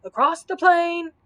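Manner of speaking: high, loud battle cry; shouted. A narrator's reading. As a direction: shout it out over the din. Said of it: "shouted Sir Hokus".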